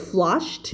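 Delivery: "flushed" ending in SH plus T; one syllable "flushed", closed with a released T. Shown as a demonstration. Teacher giving another example of the rule, clear T on "flushed". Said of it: "งั้นก็จะเป็น T".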